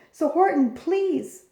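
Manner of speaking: pleading. A narrator's reading. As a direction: beg the elephant for help. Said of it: "pleaded that voice".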